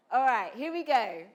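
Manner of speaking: normal talking voice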